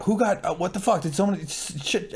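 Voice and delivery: high, startled confusion; high panicked double take; shocked. Retold or imitated. Voicing shocked onlookers.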